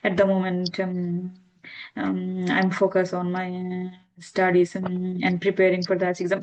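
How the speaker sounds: slow, broken, with um and uh; choppy reading full of fillers; hesitant reading. Shown as a demonstration. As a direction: stumble through it as if searching for words. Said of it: "এখন আপনি যদি এরকম পড়েন".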